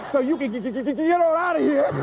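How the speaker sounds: high, laughing stammer on the g; mock an impatient customer, laughing; laughing stutter impression. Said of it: "they say".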